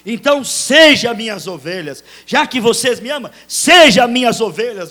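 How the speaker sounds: high, loud commanding voice; Jesus commanding. Acted. Jesus charging Peter to feed his sheep, loud. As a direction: command him to feed the flock. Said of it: "disse Jesus".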